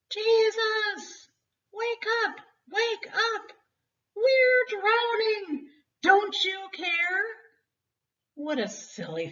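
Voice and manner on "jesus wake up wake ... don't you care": high, desperate pleading to wake him; frightened disciples begging Jesus; panicked cry. Acted. Terrified disciples shouting to the sleeping Jesus in a panic.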